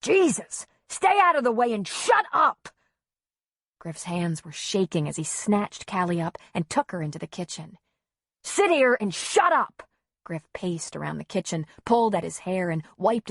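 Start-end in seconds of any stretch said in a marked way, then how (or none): 0.0-2.7 high, loud exasperated shout; hollering. A narrator's reading. Father at child in the way, exasperated holler. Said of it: "Griff hollered". 8.4-9.9 high, loud command; angry shout. A narrator's reading. Father dumping child in kitchen, angry shouted order.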